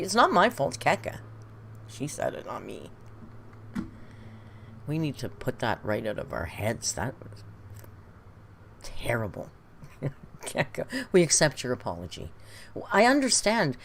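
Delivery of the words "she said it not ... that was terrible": hushed. Acted. Hushed mock disgust.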